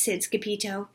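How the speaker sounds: ordinary delivery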